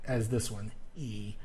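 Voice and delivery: normal talking voice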